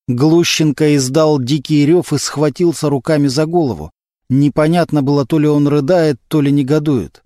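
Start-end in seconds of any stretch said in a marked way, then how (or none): none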